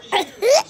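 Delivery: high burst of giggling; giggle. Real, not acted. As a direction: giggle at your own joke. Laughing at own pun.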